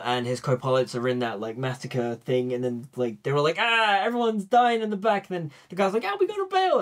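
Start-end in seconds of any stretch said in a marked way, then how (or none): 3.6-5.3 high-pitched imitation of panicking crew; hammed-up cry of alarm; mock panic. Acting out panicked airmen. 6.1-6.8 very high, squealed imitation of a panicking co-pilot; hammed-up high-pitched alarm; mock panic.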